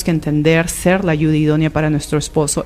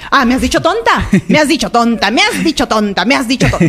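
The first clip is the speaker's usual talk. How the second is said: higher, repeated in offended disbelief; mock indignation; 4 semitones higher than usual. Wife throws her husband's insult back at him, mock indignant. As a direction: harp on it like a wounded wife.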